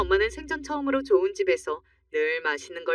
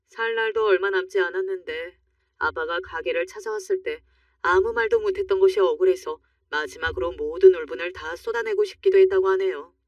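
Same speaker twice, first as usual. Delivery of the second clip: usual speaking voice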